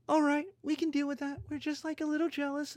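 high, soft little voice; mock reluctant acceptance. Scared rivals accepting a strong Germany, small high grudging voice. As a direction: concede it in a small falsetto.

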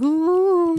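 high, drawn-out cartoon ooh; imitated high-pitched coo. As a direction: mimic a film chef's long high "ooh". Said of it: "and he's like".

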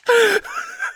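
a very high shrieking laugh. Real, not acted. Podcaster shrieking with laughter at the Chad Kirk joke. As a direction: burst out laughing hard.

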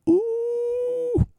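long held high "ooh"; drawn-out playful ooh; high falsetto ooh. Pretend. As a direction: coo a steady falsetto note. Reacts to Carolina matchup.

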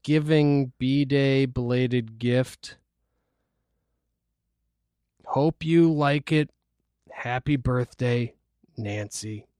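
slow, soft, halting mom voice; lightly voiced, drawn-out card reading; Nancy. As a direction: read the card out slowly and quietly. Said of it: "read it in her voice".

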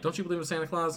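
put-on high, sing-song grown-up talking to a kid; mock high neighbor voice. Neighbor teasing the little girl, in a high mock voice. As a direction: lightly put on as the neighbor coaxing a child.

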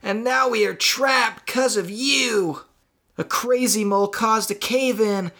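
high, exasperated rant at normal volume; angry yell. Acted. Trapped Marty blaming the mysterious voice, angry yell. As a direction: demand answers, pitch climbing to the end. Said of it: "Marty yelled aloud, his anger echoing".

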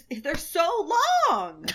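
high, drawn-out gush. Real, not acted. Fan gushing over his eyelashes, excited squeal. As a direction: squeal over it, stretching LONG.

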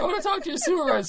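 high, loud, rushed demand; hammed-up hysterical complaint; screaming caller. Imitating prank victim demanding a supervisor, fast mock scream. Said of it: "started crying and screaming at him".

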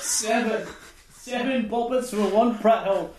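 mock proclamation. Shouted silly answer.

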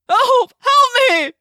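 very high, loud panicked shriek; yelping cry for help. A narrator's reading. Hunted human begs for help, loud yelping cry. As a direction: scream for rescue at full pitch. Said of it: "Loud, yelping vocalizations".

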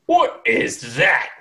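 slow, high, loud giant voice; startled giant. Acted. Giant spots something down the alleyway, startled and drawn-out. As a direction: stretch each word in hammed-up alarm.

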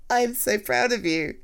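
ordinary talk, nothing marked

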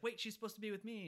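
hammed-up objection; protesting Belloc. Belloc objecting as Marion is pushed, mock protest. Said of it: "And Belloc's like".

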